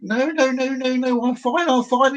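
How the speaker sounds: lightly voiced, high and carefree; a touch of a cheerful faller; breezy reply. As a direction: shrug it off while plummeting. Falling man answers again.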